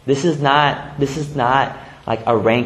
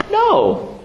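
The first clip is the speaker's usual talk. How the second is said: emphatic no. Real, not acted. Answering his own joke question.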